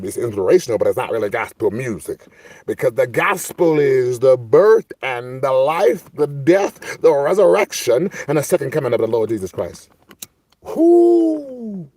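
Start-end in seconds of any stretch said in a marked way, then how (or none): 2.6-9.9 high, loud preacher cadence; preaching. Pretend. Preaches on the gospel. 10.6-11.9 high, loud, sustained whoo; church-style whoop. Pretend. Whoop after preaching burst.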